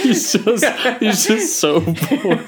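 laughing through the words, slowing down. Real, not acted. Laughing at the boring captain with friend. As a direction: giggle out how dull he is.

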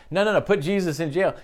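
high, quick crowd cry; mock crowd shout. Crowd shouting at Pilate, hammed up high and fast. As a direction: rattle it off like an angry mob. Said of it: "They didn't just shout".

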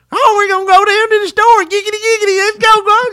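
very high, loud hillbilly voice. Retold or imitated. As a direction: play a cartoon yokel off to the store. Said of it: "you feel like that Southern stereotype".

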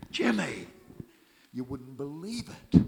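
high, loud, bursting with news; excited phone call. Retold or imitated. Calling Jimmy straight off the plane, excited. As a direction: blurt the news out eagerly.

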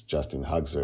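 usual speaking voice

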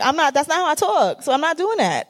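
high-pitched retold protest; acted-out objection, pitched well up; protesting refusal. Retelling own refusal to co-host.

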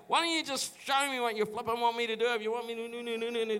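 high-pitched mock tantrum, not shouted; imitating your own frustrated outburst at God; retold tantrum. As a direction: whine it out in a rush like a hammed-up meltdown. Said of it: "I had a little tantrum with God".

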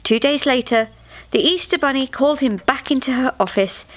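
ordinary delivery

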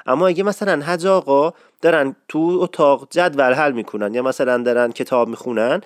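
ordinary talk

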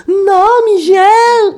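high, loud wailing protest; a Sofia Vergara-like shrill wail; whiny outcry. Retold or imitated. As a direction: howl like upset teens. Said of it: "sound like Sofia Vergara".

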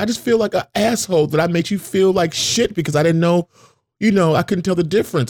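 high-pitched retelling of a tearful apology; over-apologetic crier, lightly imitated; imitating tearful apology. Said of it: "she started crying".

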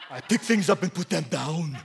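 put-on man's man voice; a hammed-up musclebound hunter; Esau. Esau showing off his strength, comic man's man voice. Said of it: "this is how I picture Esau's voice".

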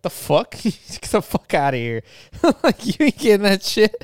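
incredulous laughing dismissal; laughing disbelief. Retold or imitated. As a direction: scoff through laughter at the absurd ask. Mocking outrageous car request.

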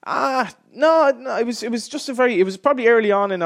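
protest, a little flustered. Real, not acted. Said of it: "You looked terrified at that point".

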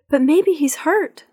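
high, slow worried plea; a girl anxiously pleading; worried child. A narrator's reading. Girl fears Granddad is hurt, high and slow.

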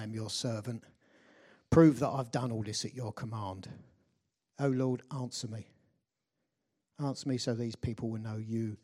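low, soft, measured prayer. A narrator's reading. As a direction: pray quietly as Elijah, in humble contrast to the shouting crowd. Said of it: "And then Elijah prayed".

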